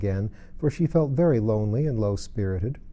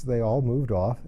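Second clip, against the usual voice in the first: ordinary delivery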